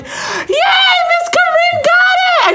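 high, loud celebratory squeal; re-enacted shriek of triumph; jumping-up cheer. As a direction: cheer like a class jumping up together. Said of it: "jumping. We're like".

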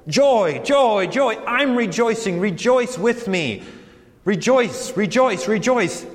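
exultant rejoicing. Retold or imitated. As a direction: proclaim each word like a joyful refrain. Voicing Paul's repeated joy from prison.

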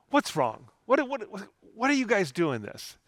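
re-enacted exasperated questioning, pitch jumping up; frustrated. Father to crying son, frustrated. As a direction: stammer it out like a fed-up dad. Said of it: "I came in frustrated about the crying".

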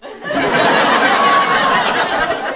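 high nervous giggle; high shy giggle. Retold or imitated. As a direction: giggle shyly instead of answering. Sister's shy response.

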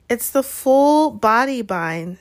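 guilty, mournful explanation; miserable. A narrator's reading. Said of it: "said Hermione miserably".

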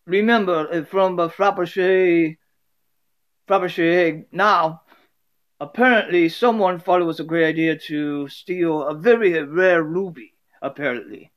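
amateur Russian character voice, lightly hammed; put-on Russian accent for an acted greeting. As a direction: play a Russian guy with a rough, homemade accent. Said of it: "the Russian guy being like".

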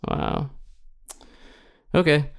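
usual speaking voice

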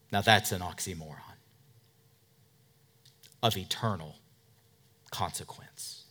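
normal talking voice

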